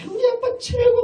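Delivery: put-on high, loud child's cheer. Children cheering their father over the chicken, high and gleeful. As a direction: squeal it like a delighted kid.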